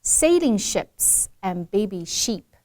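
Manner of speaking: sheep with a long E, ships with a short E, said slowly; slow "Sailing ships and baby sheep", stretching the long E in sheep; careful model reading. Shown as a demonstration. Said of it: "designed for people to differentiate the long E".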